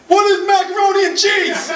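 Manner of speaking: put-on high, loud little-kid shout of an answer; kid Alex answering as a question. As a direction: squeal it out like a game-show kid. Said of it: "so he only talks in the form of questions".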